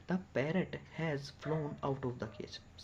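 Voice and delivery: each word drawn out slowly for students; slow dictation. Shown as a demonstration. Teacher to students, slowly dictating the sentence. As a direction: read it slowly so the class can copy.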